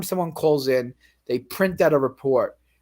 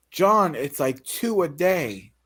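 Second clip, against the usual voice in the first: slow quote; slower than usual. Retold or imitated. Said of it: "So he goes".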